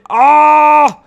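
long loud high cry of pain; pained groan. Real, not acted. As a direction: cut off by a sharp pained wail. Pin hurts mid-question.

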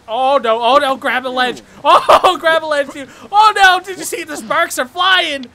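loud, high alarmed cries; frantic gamer alarm; scared, panicked. Real, not acted. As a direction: blurt it out in rising panic. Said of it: "I'm scared right now".

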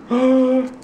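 high, gasping delight; gasp, then cry out in mock delight; high playful thrill; excited gasp.

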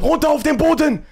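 loud barked order to get down; police command shout. Retold or imitated. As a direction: yell it like a raiding cop. Police yelling at mother. (laut gebrüllter Polizeibefehl)

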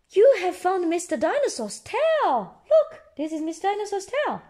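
high, eager storybook voice; high-pitched thrilled exclamation; excited discovery. A narrator's reading. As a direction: announce the find with delight.

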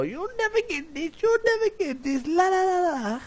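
high teasing mock sing-song voice, la la la sung up top; taunting singsong. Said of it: "She probably lay on the bed and go".

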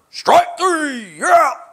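high, drawn-out umpire call; umpire's call. Acted. Umpire calling the last pitch of a ball game, high drawn-out call.

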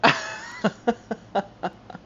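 long breathy silent wheeze; wheezing laugh. Real, not acted. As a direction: wheeze until out of air. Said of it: "I was wheezing".

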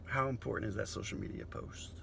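hushed unvoiced whisper. Comic whispering a jab at phone-obsessed crowd, whispered. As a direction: whisper the question like a secret.

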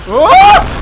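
piercingly high, loud scream; sudden shriek of alarm; startled scream. Real, not acted. Scared during play.

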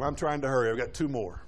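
winded, talking through short breaths. Real, not acted. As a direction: push on while out of breath. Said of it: "I'm about out of breath".

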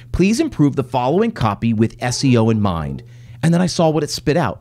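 ordinary talk, nothing marked

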